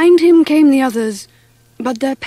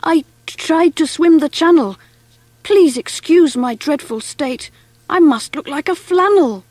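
flustered, higher-pitched apology; stammering; 5 semitones higher than usual. A narrator's reading. Bedraggled mouse explaining himself to Eloise, stammering. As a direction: stumble at the start, then apologise nervously. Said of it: "he stammered".

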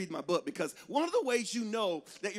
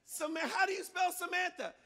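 put-on higher, softer child calling back; puzzled kid, pitched up; kid asking mom; 7 semitones higher, 3 dB quieter than usual. Kid writing names asks mom for spelling, high-pitched and puzzled. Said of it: "And I'd be like".

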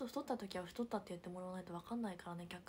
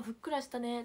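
quoted remark; 3 semitones higher, 6 dB louder than usual. Retold or imitated. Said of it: "ってことです".

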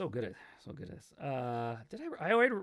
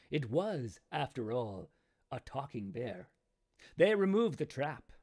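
measured storybook reading voice; story narration; 3 semitones higher, 5 dB louder than usual. A narrator's reading. Author reading his own grim fairy tale to viewers, storytelling voice. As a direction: read it like a fairy tale to listeners.

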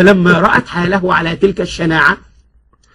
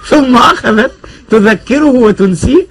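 laughing; 3 semitones higher than usual. Real, not acted. Narrator laughs at the scene.

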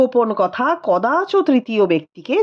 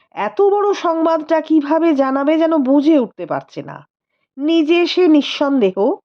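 ordinary delivery, nothing marked

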